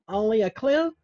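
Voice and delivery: high, doubtful Only a clue? rising at the end; lightly voiced doubter rising on clue; skeptical listener. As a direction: needle him as a doubting viewer. Imagined listener questions the claim.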